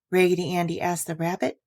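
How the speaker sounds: ordinary delivery, nothing marked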